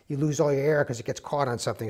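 ordinary talk

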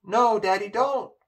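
a panicked daughter begging her father; desperate cry. A narrator's reading. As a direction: beg him to stop. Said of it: "cried Poppy".

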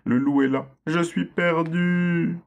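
petite voix aiguë et lente qui pleure; le lapin pris au piège sanglote de peur; pleurs apeurés. A narrator's reading. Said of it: "pleura dans une autre voix". (le petit lapin prisonnier pleure, voix aiguë et lente)

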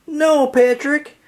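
high, slow wail; slow, high lament. A narrator's reading. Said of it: "SpongeBob wailed".

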